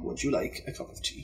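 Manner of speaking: Would you like a cup of Tea whispered softly; hushed prompt; whispered model answer. Shown as a demonstration. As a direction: whisper the correct sentence. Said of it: "gives correct form".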